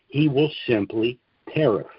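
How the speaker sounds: normal talking voice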